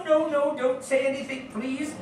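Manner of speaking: dropping to a soft hush. Acted. Scrooge asking the gentleman for secrecy, hushed. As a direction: keep it between the two of you, softly. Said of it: "I prefer to whisper this".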